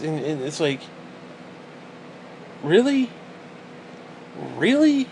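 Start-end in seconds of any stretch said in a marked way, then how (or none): 2.6-3.1 high disbelieving really; sharp disbelief; incredulous. Real, not acted. Incredulous at dating profiles. 4.6-5.1 even higher, falling disbelief; scoffing disbelief; incredulous. Real, not acted. Incredulous at dating profiles.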